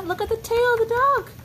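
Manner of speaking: excited. Real, not acted. Parent pointing out the dog's tail to toddler, excited and high. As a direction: make the wagging tail a big thrill.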